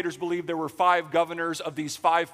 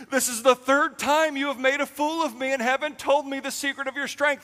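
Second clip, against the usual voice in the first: higher, louder indignant rebuke; mock angry Delilah; 8 semitones higher, 4 dB louder than usual. Delilah rebukes Samson, higher indignant mock voice. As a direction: scold him for making a fool of you.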